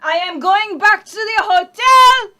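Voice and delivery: loud, huffy French exit; indignant. Acted. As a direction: storm off, offended. French lady storms off.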